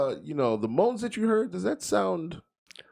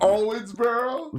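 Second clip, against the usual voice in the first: higher, slow drawn-out mock Southern; mock Kentucky accent; 13 semitones higher, much slower than usual. Friend teasing Depp's hometown in a slow mock drawl. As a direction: drag it out like a Kentucky local. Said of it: "that's how we all talk in Owensboro".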